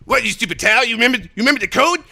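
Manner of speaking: second cartoon character answering, incredulous; South Park voice. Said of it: "Do the South Park voice".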